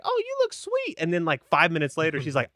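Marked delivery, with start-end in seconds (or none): 0.0-1.0 very high imitation coo; high-pitched retold admiration. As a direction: lift way up into a mock-tender squeak. His first reaction to her, retold.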